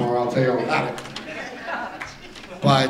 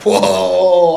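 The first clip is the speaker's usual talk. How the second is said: loud, high, drawn-out whoa; mock shocked whoa; 7 semitones higher, 8 dB louder than usual. Captain snubbed as she walks away, mock shock. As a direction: stretch it out in hammed-up disbelief. Acts his reaction to being snubbed.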